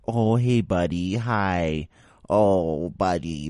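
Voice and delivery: hammed-up baby voice for a pet; mocking baby voice. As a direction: sneer at dog owners by aping their baby talk. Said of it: "talk to their animals with the baby voice".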